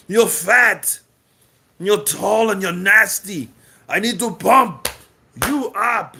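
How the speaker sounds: Arnold Schwarzenegger. Retold or imitated. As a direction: boom it out like a muscle-man movie star. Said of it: "Great Arnold Schwarzenegger".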